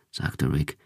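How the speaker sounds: ordinary delivery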